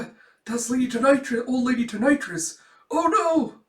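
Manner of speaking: high, loud stammering alarm; hammed-up student dread; terrified recognition. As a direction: panic rising to a cry.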